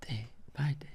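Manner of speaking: under-breath whisper. Real, not acted. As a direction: whisper it to yourself. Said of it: "whispers the quote to himself".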